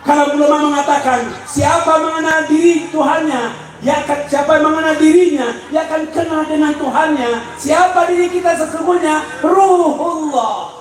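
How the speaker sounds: high, driving preacher's delivery; pressing sermon, pitched high; forceful preaching. Real, not acted.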